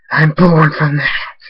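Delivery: slow, loud, distorted villain; monstrous villain voice. Acted. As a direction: boom like a dark creature. Villain reveals origin.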